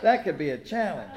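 chuckling, amused rise; tickled by the idea; real laughter breaking in; laughing. Real, not acted. Repeats the joke, laughing.

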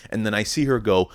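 ordinary talk, nothing marked

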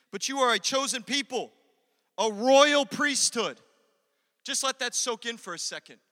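loud, high proclamation of scripture; impassioned pulpit reading, high and loud; full-force preaching. Real, not acted. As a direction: declare it with force, stretching key words.

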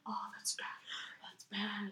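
hushed, uncomfortable whisper; whispered uneasy child. Retold or imitated. Remembering watching with parents, uneasy whisper. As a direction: whisper it like a squirming kid. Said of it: "I was like".

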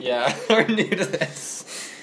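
laughing. Real, not acted. Laughing admission.

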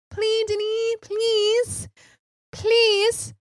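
very high, squeaky begging; mock pleading. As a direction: beg theatrically in a tiny high voice. Begging director in acted exchange.

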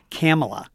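Kamala said CAM-uh-luh, first vowel as in "cat"; mispronounced name. Retold or imitated. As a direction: stress the first syllable of Kamala, with a short flat A. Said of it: "I'm a stickler on that one".

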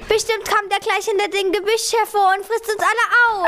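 put-on high, frightened childish lament; wailing. A narrator's reading. Pupsi fears the lion will eat them all, wailing. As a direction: wail it out in rising dread. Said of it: "jammerte Pupsi".

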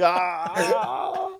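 high, loud yelp of frustration; mock cry of defeat. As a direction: yelp like you just threw the match. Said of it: "I'm like".

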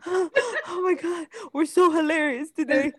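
mock-proud squeal at their own jokes; high amused squeal. Real, not acted.

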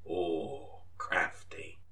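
soft whispered aside; hushed commentary. Acted. Chess host whispering an impressed aside. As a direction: breathe it like a golf commentator.

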